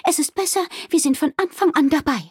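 high-pitched innkeeper, deciding; decide firmly in a high character voice; resolved innkeeper Wilma. Acted. Wilma deciding to help Amalie, resolved.

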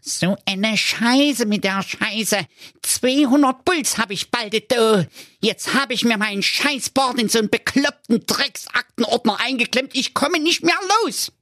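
very high, squeaky, cursing voice; a stuck official ranting in a cartoon squeak; desperate tax investigator. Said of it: "verzweifelt auf und nieder und rief".

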